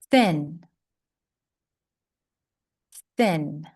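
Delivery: "Thin" with a breathy unvoiced th. Shown as a demonstration. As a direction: air only on the th of "Thin". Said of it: "That one is unvoiced".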